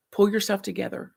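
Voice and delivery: firm, clipped uncle's command; uncle's order. Retold or imitated. Uncle scolding an upset child, firm command. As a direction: quote the stern uncle telling you to shape up. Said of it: "he would say".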